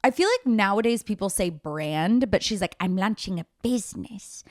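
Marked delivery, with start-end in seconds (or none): 2.8-4.4 lightly voiced Meghan mock, dwelling on "business"; "I'm launching a business" with a faint put-on poshness in her own voice; imitating Meghan Markle. Host mocking Meghan announcing her business, light imitation. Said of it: "she keeps saying business".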